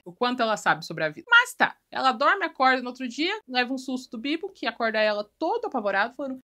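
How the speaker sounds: normal talking voice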